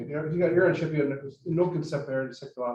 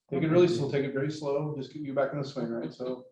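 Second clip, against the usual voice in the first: ordinary talk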